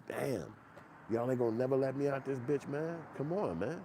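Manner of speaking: high, whiny prisoner's lament; mock despair. Prisoner begging guards to release him, whiny and high.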